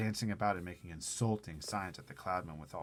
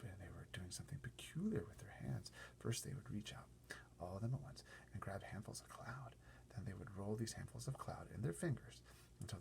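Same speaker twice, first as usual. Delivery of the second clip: hushed whisper; whispered narration; 10 dB quieter than usual. Acted. Reading the sky scene in a whisper. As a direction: keep it a secret whisper. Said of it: "held whispered narration".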